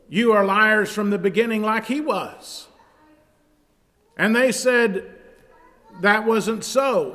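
high, loud, fervent preaching; preaching at full force. Acted. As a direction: drive the scripture home. Preaches John 8 dispute loudly.

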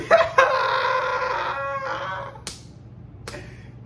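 high airy wheezing laugh; can't stop laughing at the memory; breathless laugh. Real, not acted. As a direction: lose it in a silent wheeze. Laughing at man grabbing stuff.

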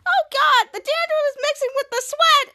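very high, loud disgusted shriek; grossed-out shriek. Acted. Charlotte shrieks in disgust as dandruff mixes with sweat. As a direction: shriek it like you're covered in goo.

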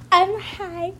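acting sweet caller. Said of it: "Just be like".

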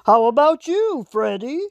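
lightly voiced king. A narrator's reading.

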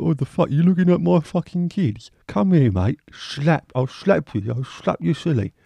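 hammed-up bear threatening you over its cubs; mock angry bear. Bear scolding a staring stranger, mock angry voice.

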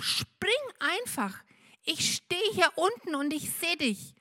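high, urgent but reassuring call; father calling reassuringly. A narrator's reading. Father calls up to Benno, high and reassuring. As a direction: promise him you'll catch him. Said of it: "ruft der Papa wieder".

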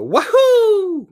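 very high, loud mock yelp; Mario's wahoo. Quoting the trailer's Mario, very high and loud. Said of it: "you hear him go".

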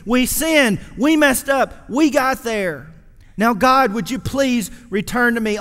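preacher voicing a repentant plea to God; pleading shout. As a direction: cry it out to God, begging. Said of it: "Instead of saying".

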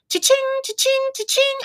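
squeaky high cash register sing-song; mock cash register chime; high cha-ching. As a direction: chirp it like a till ringing. Mimicking cash register sound.

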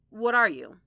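interrupting. A narrator's reading. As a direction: cut in sharply with a challenge. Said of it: "Foggy interrupted".

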